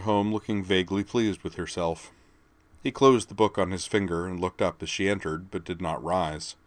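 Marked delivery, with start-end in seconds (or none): none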